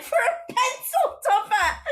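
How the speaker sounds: high, loud outraged cartoon baby; drawn-out, high-pitched indignant cry; Stewie. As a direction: squeal it slowly in mock outrage. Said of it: "And Stewie's like".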